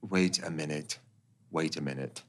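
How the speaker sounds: low, soft conspiratorial hush; hushed suspicion. Acted. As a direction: lean in and whisper the suspicion. Dramatic suspicious hush in scene.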